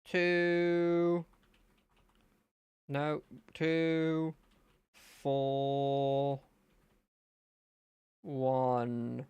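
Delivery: slow, held monotone words; drawn-out numbers. Real, not acted. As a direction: stretch each number out slowly. Drawing out each number.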